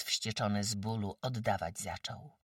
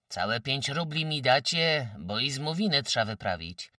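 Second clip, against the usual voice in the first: put-on louder, firmer young man's demand; more assertive; 4 semitones higher, 7 dB louder than usual. A narrator's reading. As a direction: speak up with confidence. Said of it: "jeno jakoś pewniej". (pewniejszym, głośniejszym tonem)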